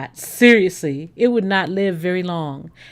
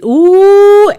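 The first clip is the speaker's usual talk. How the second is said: excited ooh; 11 semitones higher, 14 dB louder than usual. Real, not acted. Sees Andy's comment.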